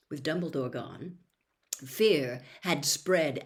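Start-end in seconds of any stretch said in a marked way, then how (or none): none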